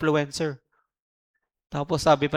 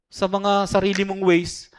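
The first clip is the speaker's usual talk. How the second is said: ordinary delivery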